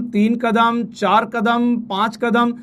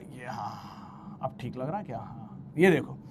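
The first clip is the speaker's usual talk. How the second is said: much softer, drifting into a whisper; muttering to himself; 10 semitones lower, 16 dB quieter, slower than usual. Real, not acted. Teacher checking his drawing, a soft near-whispered mutter. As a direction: mumble it under your breath while fixing the drawing.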